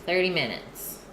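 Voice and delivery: ordinary talk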